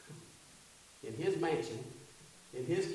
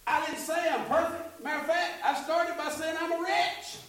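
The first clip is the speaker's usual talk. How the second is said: high, impassioned sermon voice; impassioned confession of being a wretch; full-force preaching; 8 semitones higher, 3 dB louder than usual. Acted. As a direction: testify loud and high.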